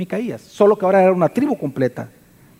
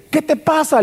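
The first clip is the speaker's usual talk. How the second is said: scornful, pitched higher; contemptuous; 9 semitones higher than usual. Acted. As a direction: sneer at the man you robbed. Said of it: "le hablan despectivamente a Micaías".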